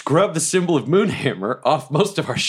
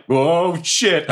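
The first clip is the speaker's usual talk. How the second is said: high, startled curse; sudden alarm at the boats; 5 semitones higher than usual. Retold or imitated. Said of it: "And I'm like".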